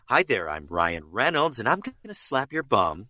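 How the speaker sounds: swooping Canadian Ace Ventura voice; Ryan Reynolds. Acted. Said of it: "a more Canadian Ace Ventura".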